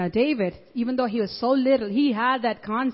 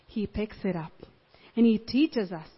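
ordinary talk

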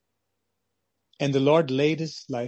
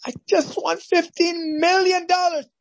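much higher, breathless with joy; ecstatic announcement; 12 semitones higher than usual. Acted. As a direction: shout out the jackpot. Winner announces lottery win.

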